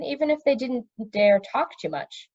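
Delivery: ordinary delivery, nothing marked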